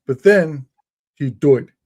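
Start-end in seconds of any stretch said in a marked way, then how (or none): 1.1-1.7 broad English "doyed" as punchline; tour guide's English accent. Retold or imitated. Visitor ends the king's story, English accent. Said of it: "That's how his accent is".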